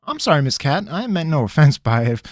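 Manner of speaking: a cornered trickster pleading; nervous country rogue. Acted. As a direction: squirm and stall, talking fast. Pickett voice.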